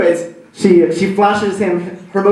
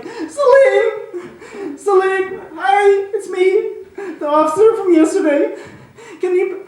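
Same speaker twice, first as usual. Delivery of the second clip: much higher, laughing, hammed-up blubbering; mock weeping. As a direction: plead through fake sobs. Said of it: "He starts weeping".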